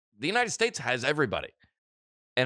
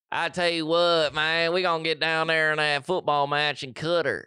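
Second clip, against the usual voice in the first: slow, louder, higher Southern hillbilly drawl; mock hillbilly imitating how Qatar sounds; hillbilly accent; 4 semitones higher, 4 dB louder than usual. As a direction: ham up a rural Southern yokel, slow and loud. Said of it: "It sounds hillbilly".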